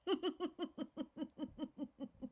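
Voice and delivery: high airy laugh mid-exercise; real laughter. Real, not acted. Laughter coach breaking into a laugh during smile exercise. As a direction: laugh through the stretched smile. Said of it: "laughter, as you can tell, is always okay".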